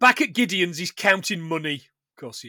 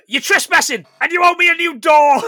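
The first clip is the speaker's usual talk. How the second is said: villain quote; 9 semitones higher, 9 dB louder than usual. Retold or imitated. Quoting the villain's line.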